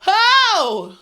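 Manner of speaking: loud joking battle cry; hyped-up shout. Real, not acted. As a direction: fire her friend up. Hyped threat over friend's tears.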